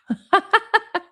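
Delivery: bursting into a high laugh. Real, not acted. Laughs at Dom's text.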